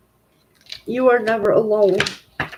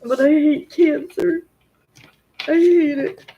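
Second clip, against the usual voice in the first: tearful outburst; 8 semitones higher, 4 dB louder than usual. Real, not acted. Grieving daughter cursing cancer.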